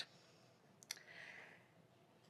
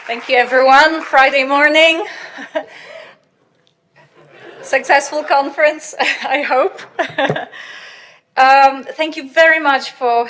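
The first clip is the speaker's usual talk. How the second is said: booming, much louder, filling the hall; 4 semitones higher, 14 dB louder than usual. Real, not acted. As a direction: project hard to the back row. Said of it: "I have a very loud voice".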